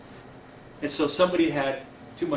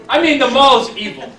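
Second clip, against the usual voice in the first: mock-serious punchline; 9 semitones higher, 12 dB louder than usual. Real, not acted. Punchline about the mall.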